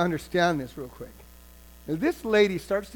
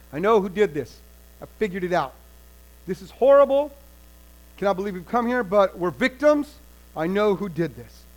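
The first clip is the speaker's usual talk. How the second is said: louder accusing announcement; angry proclamation; 5 dB louder than usual. Acted. King announces to the people who is to blame, loud. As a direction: blame the prophet before the crowd. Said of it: "he announces to the people".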